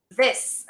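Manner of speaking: "this" ending in a hissed S, no Z; prefix with S sound. Shown as a demonstration. Teacher demonstrating prefix dis, "this" with final S. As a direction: end "this" on a clear S sound. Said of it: "The sound is always with an S".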